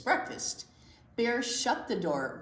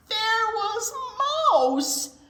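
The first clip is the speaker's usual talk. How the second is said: surprised; 14 semitones higher, 7 dB louder, slower than usual. A narrator's reading. Mouse found in fridge.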